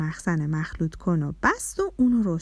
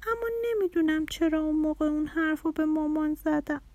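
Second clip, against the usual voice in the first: put-on high, soft little-girl voice; hesitant child, pitched high and gentle; little daughter; 7 semitones higher, 3 dB quieter than usual. A narrator's reading. Little girl confessing to her father about hurting mum, high and hesitant.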